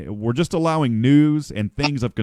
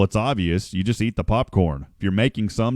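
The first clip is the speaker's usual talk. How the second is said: ordinary talk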